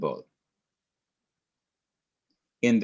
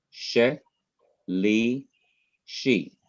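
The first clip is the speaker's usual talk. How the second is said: "She, li, she" in separate syllables, a pause after each; much slower than usual. Shown as a demonstration. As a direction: break "She, li, she" into three isolated beats. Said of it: "it's a three syllable word".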